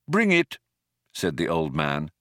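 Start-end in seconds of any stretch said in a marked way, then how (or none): 0.1-0.6 sudden firm command; decisive. A narrator's reading. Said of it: "a note of decision suddenly present".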